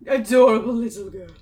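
loud, showy count voice. Acted. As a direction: announce it like a counting vampire.

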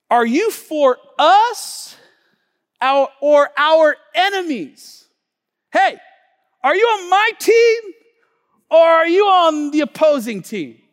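Joshua's desperate question; urgent plea. Acted. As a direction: cry out for an answer. Voices Joshua pleading.

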